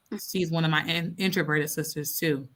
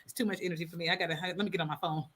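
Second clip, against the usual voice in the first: quiet rushed retreat; 7 dB quieter, faster than usual. Retold or imitated. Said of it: "now I'm just like".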